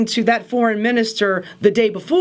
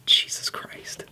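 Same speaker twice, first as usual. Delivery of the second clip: whispered aside; 8 dB quieter than usual. Real, not acted. Disgusted aside after assault talk.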